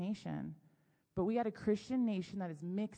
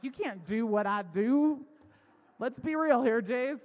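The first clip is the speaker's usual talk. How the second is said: louder, drawn-out telling-off; retold scolding; 4 semitones higher, 8 dB louder than usual. Wife telling husband he can't do what she does, scolding. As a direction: lay down the law, loudly.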